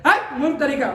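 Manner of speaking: hammed-up harsh teacher shooing away a pupil; mock scolding. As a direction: bark it like an impatient teacher, half laughing. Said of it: "तो ये तरीका भगा देने का".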